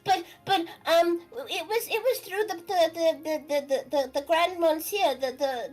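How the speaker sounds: high, halting, stammering little fish voice; a timid young fish explaining itself; shy little fish. Acted. Plays the little fish answering.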